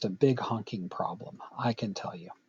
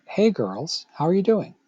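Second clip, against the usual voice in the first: friendly, rising polite hello to a group; polite greeting; 6 semitones higher, 4 dB louder than usual. Pretend. Someone greeting a group of girls politely, bright and courteous. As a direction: greet the girls nicely, climbing higher on doing. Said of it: "asking politely".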